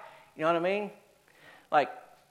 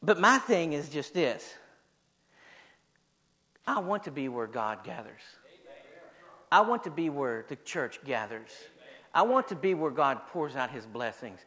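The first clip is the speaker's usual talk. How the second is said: very low, soft, earnest declaration; intimate, solemn pulpit confession; hushed preaching; 6 semitones lower, 6 dB quieter than usual. Real, not acted.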